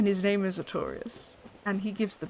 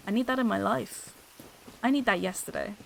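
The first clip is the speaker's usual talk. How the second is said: ordinary delivery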